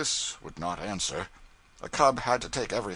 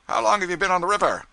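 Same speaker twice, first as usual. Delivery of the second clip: high, loud, fast interrogating pilot; gruff pilot; 10 semitones higher, 8 dB louder, much faster than usual. A narrator's reading. Pilot Brown grilling his new cub, loud and curt. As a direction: bark the question like a crusty pilot.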